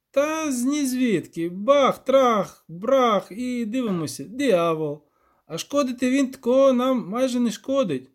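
rambling villager with punchy exclamations; rustic village elder. Acted. Elder counting off answers for the witcher, animated rustic voice.

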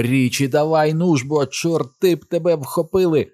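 high, urgent yelling; panicked shouting, shaking someone awake; frantic holler. A narrator's reading. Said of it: "загорлав Бен і почав трусити його".

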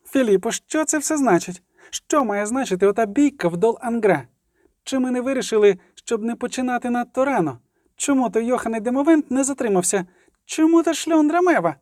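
high-pitched, indignant volley of questions; outraged questioning. A narrator's reading. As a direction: demand answers, pitch climbing to the end. Sabrina's outraged questions at the council.

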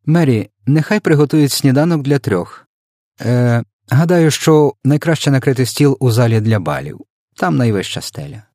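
lightly voiced queenly command; giving orders. A narrator's reading. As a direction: instruct the maid as a queen. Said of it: "звеліла".

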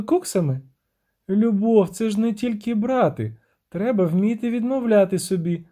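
high-voiced siren, reproachful; indignant siren. Siren scolds her lover for sacrificing nothing, high reproach. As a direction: complain in a high, lilting voice.

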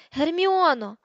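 high, loud cry for someone out of reach; bellowing. A narrator's reading. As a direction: yell the name out in desperation. Ron calls out after the scream.

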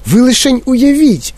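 high, excited exclamation; Містер Вонка. A narrator's reading. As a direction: pitch it way up with enthusiasm. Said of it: "вигукнув містер Вонка". (захоплений високий вигук)

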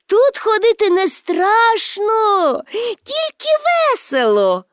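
high, drawn-out playful call. A narrator's reading. Pippi urges policemen on the roof not to fear, calling out. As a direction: sing it out high and slow across the roof. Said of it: "гукнула їм Пепі".

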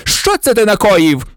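high, angry accusation; furious yell. A narrator's reading. Snape accusing Potter in the ward, yelling. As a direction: shout the question as an accusation. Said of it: "зарепетував він".

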